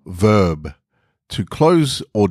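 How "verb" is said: ordinary delivery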